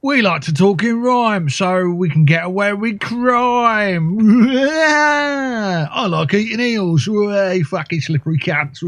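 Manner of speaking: slow, drawn-out Cockney caricature with cackles; mock Cockney voice. Player boasting as an eel-eating Cockney, slow hammed-up accent. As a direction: ham up a cartoon Cockney, stretching every rhyme. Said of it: "Obviously, I enjoy that voice".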